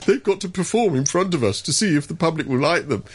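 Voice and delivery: mock a prim little bureaucrat. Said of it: "these little prim bureaucrats sitting there going".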